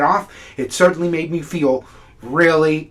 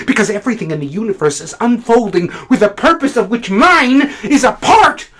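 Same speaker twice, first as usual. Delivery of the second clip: higher-pitched, driving declaration; impassioned tirade; 9 semitones higher, 5 dB louder than usual. Acted. Critic defending his whole purpose in life, impassioned. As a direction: build into a fervent, ringing argument. Monologue builds to impassioned outburst.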